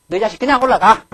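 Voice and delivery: put-on father shouting at his child to go up; angry father. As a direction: bark the order like an angry dad. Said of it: "아버지가 좀 화가 난 거예요".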